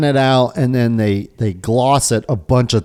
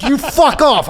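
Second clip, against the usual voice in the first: much higher, mock-furious comeback; angry retort; 13 semitones higher, slower than usual. Man retelling his yelling match with a stranger, angry. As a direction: throw it back at him, high and heated.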